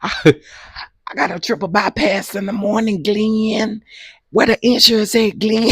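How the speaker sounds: high, slow hammed-up whine; wife mock-whining to Glenn about surgery; mock whining wail. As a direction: whine it like she's about to cry. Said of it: "That's how she said it".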